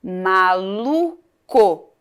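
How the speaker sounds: MALUCO drawn out, pause before CO; final syllable isolated. Shown as a demonstration. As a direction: say MALU... CO very slowly. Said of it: "Olha a sílaba final".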